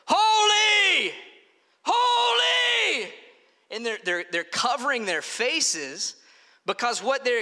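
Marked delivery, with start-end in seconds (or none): 0.1-3.1 high, very loud drawn-out cry; huge high-pitched proclamation, each word held long; full shout. Acted. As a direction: shout it out like an overwhelmed seraph. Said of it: "They're actually shouting to one another".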